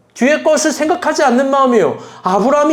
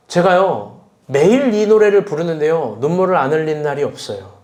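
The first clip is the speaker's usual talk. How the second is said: usual speaking voice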